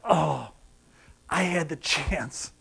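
soft, slow, regretful lament; quiet anguish. Acted. Preacher voicing eternal knowledge of a lost chance, soft regret. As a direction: lament it softly and slowly. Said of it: "the emotional anguish of".

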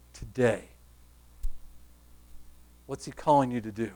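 very soft, low preaching with long pauses; low, subdued, solemn closing appeal; hushed altar appeal. Acted. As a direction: quietly invite the congregation to respond, leaving space between phrases.

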